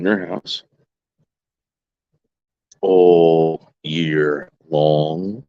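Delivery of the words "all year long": drawn out. Real, not acted. Taunting about his lights.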